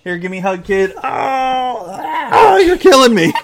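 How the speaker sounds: high, loud celebrating; excited cheering. Real, not acted. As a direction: cheer and squeal with joy. Celebrating midnight, hugging.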